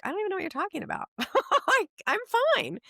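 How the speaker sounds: high, fast, laughing brush-off; laughing denial. Retold or imitated. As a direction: rattle it off and laugh it away. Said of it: "I'm like".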